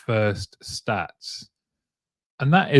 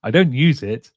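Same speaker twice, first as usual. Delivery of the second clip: higher, swooping jokey voice; mock buyer; 4 semitones higher, 4 dB louder than usual. Host joking as a buyer of the tech, mock carefree. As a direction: airily admit it, playing it for laughs.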